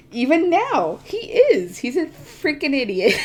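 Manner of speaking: high-pitched ranting emphasis; worked-up insistence. Real, not acted. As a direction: insist hotly, voice jumping up. Ranting about a disliked character.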